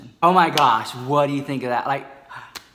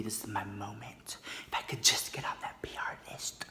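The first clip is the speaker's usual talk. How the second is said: soft unvoiced whisper; whispered thoughts; 4 semitones lower, 12 dB quieter than usual. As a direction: whisper it like a secret wish. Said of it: "I was like".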